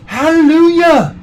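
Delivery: loud, high, drawn-out hallelujah; a slow, soaring hallelujah for Alfredo pizza; mock hallelujah. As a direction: belt it like a joyful praise.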